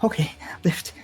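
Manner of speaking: high, tentative character voice; lightly acted high voice; voiced injured character. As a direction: give a careful go-ahead as the hurt victim. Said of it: "She says".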